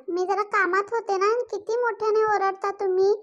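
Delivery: ordinary delivery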